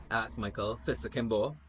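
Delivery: plain reading voice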